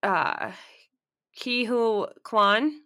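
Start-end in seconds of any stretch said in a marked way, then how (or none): 1.4-2.7 "Ke Huy Quan" slowly, as KEE-HOO-KWAN, pitched up; tentative pronunciation. Shown as a demonstration. Checking a name with the co-host, "Ke Huy Quan" tentatively as KEE-HOO-KWAN. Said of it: "Is that how you pronounce his name?".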